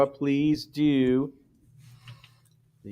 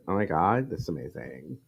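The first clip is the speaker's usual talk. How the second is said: put-on breathy Valley girl sigh trailing off; mock Valley girl gush; 8 semitones lower, 7 dB quieter than usual. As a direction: imitate her cooing over it. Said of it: "I'll bet she does it".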